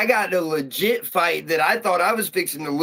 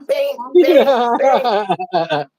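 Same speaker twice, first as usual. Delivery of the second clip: higher, louder bangs with a laugh; punch sound effects; 6 semitones higher, 4 dB louder, much slower than usual. Retold or imitated. Telling a buddy about punching a guy, loud laughing bangs. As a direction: punch out each bang like a hit.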